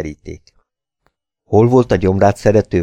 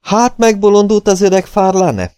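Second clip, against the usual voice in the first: gruff, raised voice of an angry planter; rough; 13 semitones higher, 4 dB louder than usual. A narrator's reading. Planter rudely mocking old Farlane's decision, gruff. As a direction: bark the question harshly, higher and louder. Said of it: "mondta durván Van Hagen".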